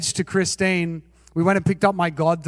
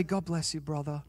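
much softer, lower, calm and gentle; calm blessing; 4 semitones lower, 13 dB quieter than usual. Retold or imitated. To the driver who cut him off, a mock-calm quiet blessing. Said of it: "I calmly say".

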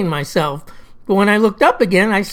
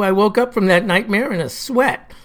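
usual speaking voice

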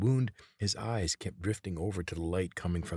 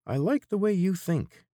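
high, loud eager agreement; nasal voice; 7 dB louder than usual. A narrator's reading. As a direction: perk up at the offer of wine. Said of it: "the nasal voice responded".

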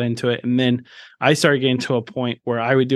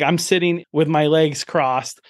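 ordinary talk, nothing marked

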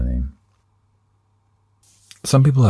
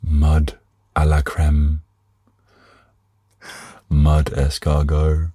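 put-on French accent. Mock fancy French dish names.

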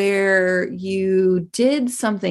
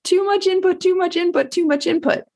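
overwhelmed outburst; 11 semitones higher than usual. Retold or imitated. After her presentation, too drained for people, high frazzled outburst. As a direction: play it like your head is overloaded. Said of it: "I was like".

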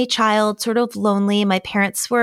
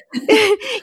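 laugh; 13 semitones higher than usual. Real, not acted. Laughs at her own correction.

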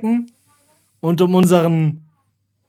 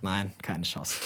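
much lower and softer, a dead-serious no; quoted flat refusal; 9 semitones lower, 15 dB quieter, slower than usual. Retold or imitated. Co-host's fearful refusal to confront his wife, very low and soft. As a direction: refuse it like you're scared to even try. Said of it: "Und er hat gesagt".

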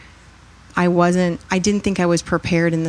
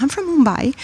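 lightly voiced Indian woman; 8 semitones higher than usual. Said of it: "And she's like".

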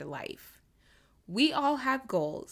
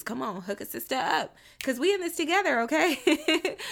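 playful hype; 5 semitones higher, 5 dB louder than usual. Real, not acted. Playful appeal to listeners.

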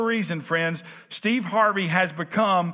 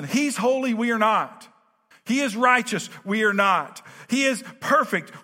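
rising preacher cadence, higher pitched and punchy; preaching; 4 semitones higher than usual. Acted. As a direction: build each line like a call from the pulpit. Preaching builds on God's holiness.

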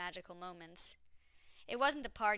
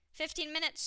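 high, louder announcement; announcing; 9 semitones higher, 5 dB louder than usual. A narrator's reading. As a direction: announce it brightly, pitched up high. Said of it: "she announced".

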